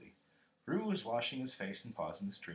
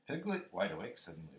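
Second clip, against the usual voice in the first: usual speaking voice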